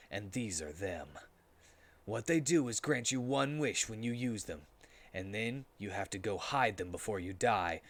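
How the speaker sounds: low, soft, brooding eulogy; Shadow's solemn speech. A narrator's reading. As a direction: speak like a dark hero mourning a friend.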